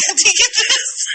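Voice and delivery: talking through the giggles; can't keep a straight face; giggling. Real, not acted. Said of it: "we have the giggles".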